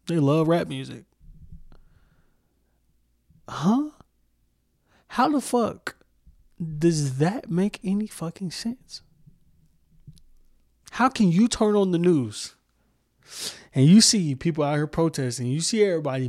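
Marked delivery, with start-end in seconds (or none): 3.5-12.5 much higher, slow, disbelieving; can't believe what he's hearing; incredulous outburst. Real, not acted. Ranting at hypocritical rap listeners, incredulous disbelief.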